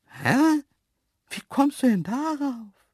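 very high cartoon animal voice; baffled antelope. Antelope puzzled by the human's question, high baffled voice. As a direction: ask in high-pitched bafflement.

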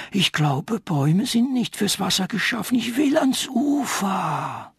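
high, strained tree spirit. A narrator's reading. Tree character voiced high.

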